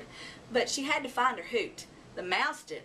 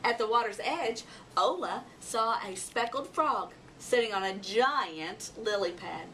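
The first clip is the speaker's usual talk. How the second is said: usual speaking voice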